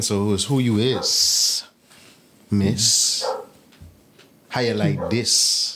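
slow Bahamian lilt; Bahamian accent. Shown as a demonstration. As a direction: speak it in Bahamian dialect. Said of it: "I asked Bahamian".

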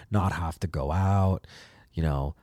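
usual speaking voice

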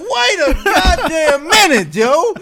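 high, loud, drawn-out protest; comic outrage. Retold or imitated. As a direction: cry out in mock indignation, stretching each word. Retold outraged comeback, laughing.

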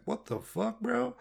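shocked, voice jumping up on bro; high-pitched disbelief at the boss; startled high "bro". Retold or imitated. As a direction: caught off guard by the call. Boss says not doing good.

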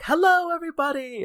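very high, loud singsong hello; carefree manager greeting. Retold or imitated. Manager waltzes in at noon greeting staff, carefree. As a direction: waltz in with a mock-cheery greeting. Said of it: "here comes waltzing in the regional manager".

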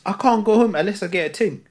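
ordinary talk, nothing marked

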